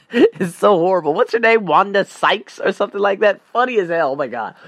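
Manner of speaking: normal talking voice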